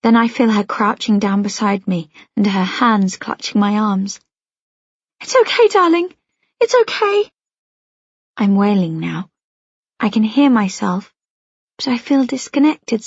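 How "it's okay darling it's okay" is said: comforting. Acted. Mother comforts crying daughter.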